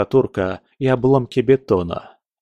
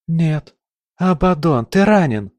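alarmed cry; 6 semitones higher, 4 dB louder than usual. A narrator's reading. Alarmed at wounded golem.

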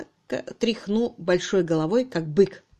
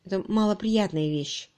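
ordinary delivery, nothing marked

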